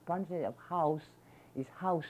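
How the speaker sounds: usual speaking voice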